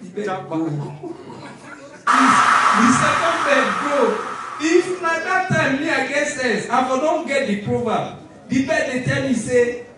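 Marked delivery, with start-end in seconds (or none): 2.1-6.7 slow, loud words broken by laughter; cracking up at his own story; laughing. Real, not acted. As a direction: laugh through the punchline. Punchline, laughing.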